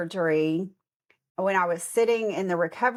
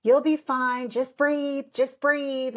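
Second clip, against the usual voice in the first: higher mock-soothing nurse voice; dismissive nurse; 7 semitones higher, slower than usual. Nurse telling the patient to just breathe, mock-dismissive. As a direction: imitate the nurse waving off her worry. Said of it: "she was like".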